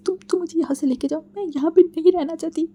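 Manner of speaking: high, loud tearful pleading; crying plea. A narrator's reading. Wing begs Wangji to take him away, crying and pleading. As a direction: sob it out, begging to be taken away. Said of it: "टूट पड़ा and रोने लगा".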